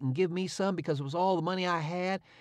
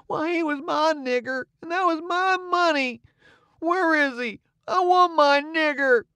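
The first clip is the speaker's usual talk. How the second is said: louder, higher, pleading through tears; crying boy; 8 semitones higher, 6 dB louder than usual. A narrator's reading. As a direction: wail it like a lost boy, slowing at the end. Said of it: "and begun to cry".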